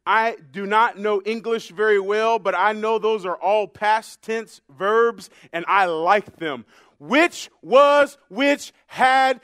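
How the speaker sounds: loud, measured preacher's declaration; emphatic preaching. Acted. Preacher rejoicing over past tense verbs, loud emphatic preaching. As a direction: hammer out each phrase for the congregation.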